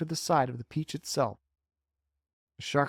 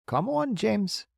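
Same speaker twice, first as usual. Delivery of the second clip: coaxing; 8 semitones higher, slower than usual. Acted. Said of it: "coaxing him".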